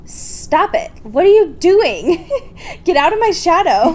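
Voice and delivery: high, loud, laughing mock of Bella snapping. Friend imitates Bella snapping at someone, high laughing mock. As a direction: imitate Bella telling her off, half laughing. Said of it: "Bella just, like, turns around and is like".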